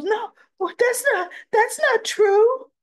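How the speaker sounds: retold crying denial, voice pitched high; tearful denial. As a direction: push back through tears, pitch way up. Molly denies it, near tears.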